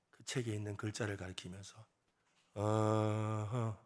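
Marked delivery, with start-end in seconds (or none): 2.5-3.8 long, low hum of understanding; imitated dawning "aha". Mother finally understanding her son, long low "aha". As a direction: draw it out slowly as it sinks in. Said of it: "알아들은 거예요".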